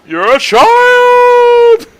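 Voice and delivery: very high, loud, stretched shriek; hammed-up shriek; anime scream. Screaming at villain.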